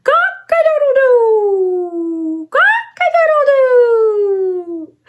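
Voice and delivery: high, slow crowing; cock-a-doodle-doo drawn out high; rooster crow. Shown as a demonstration. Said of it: "I'll do it with you".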